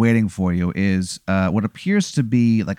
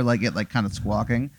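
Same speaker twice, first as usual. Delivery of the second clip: ordinary talk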